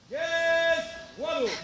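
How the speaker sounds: slow drawn-out echoed command. Acted. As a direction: repeat the order back slowly. Echoing the command slowly.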